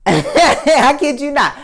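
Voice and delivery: slow, high, laughing through the words; can't keep a straight face. Real, not acted. Laughing at his own story.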